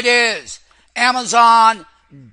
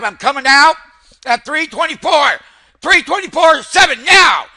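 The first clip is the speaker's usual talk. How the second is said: higher and louder, calling out the exit; excited shout; 6 semitones higher, 6 dB louder than usual. Real, not acted. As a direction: bark the prices as you get out. Trader exits position, shouting prices.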